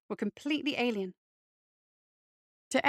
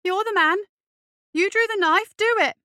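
high, loud goading; worked-up, shouted dare; trembling fury; 9 semitones higher, 8 dB louder than usual. A narrator's reading. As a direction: egg the bully on, voice pitched right up. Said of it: "his voice trembling".

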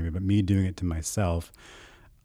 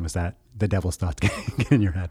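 ordinary talk

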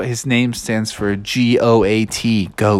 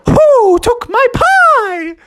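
loud, high drawn-out hoot; high owl cry; 5 dB louder, much slower than usual. Acted. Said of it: "the owl popped out and said".

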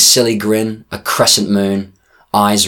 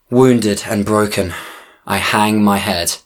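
plain reading voice

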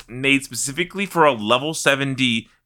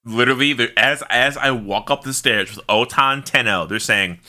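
normal talking voice

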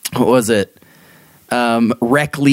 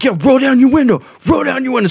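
much higher shouted challenge; brother yelling; 12 semitones higher, 3 dB louder, faster than usual. Retold or imitated. Brother shouting at kids in car, higher and louder. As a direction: yell to provoke the kids. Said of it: "he's like".

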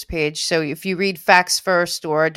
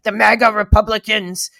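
mocking opponents; 3 semitones higher, 6 dB louder than usual. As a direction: imitate opponents spitting out a label. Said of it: "You hear them all".

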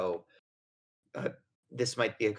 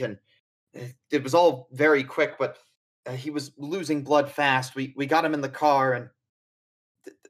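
louder, stumbling, shaken account; shaken NPC; 8 dB louder than usual. Acted. NPC telling the professor how Charlie was shot, shaken and louder. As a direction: blurt out the story in distress. GM voices upset NPC recounting shooting.

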